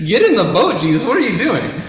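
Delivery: high, loud, half-laughing plea; exasperated urging. Pretend. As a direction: urge him into the boat, amused and alarmed. Imagined exasperated reaction to Jesus.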